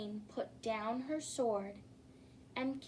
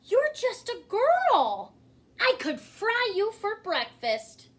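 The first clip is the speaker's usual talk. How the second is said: much higher and louder, amazed; astonished roar; 10 semitones higher, 9 dB louder than usual. Acted. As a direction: burst out in shock. Dragon astonished, threatening.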